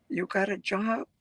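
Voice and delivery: usual speaking voice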